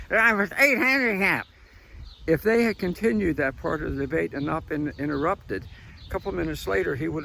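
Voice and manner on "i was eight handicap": high, loud mocking old man; Biden impression. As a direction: shout a boast like a cranky old man. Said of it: "he said".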